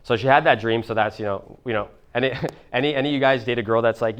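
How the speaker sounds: ordinary delivery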